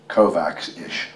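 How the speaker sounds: plain reading voice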